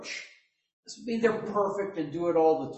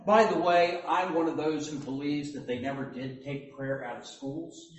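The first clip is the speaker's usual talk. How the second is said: usual speaking voice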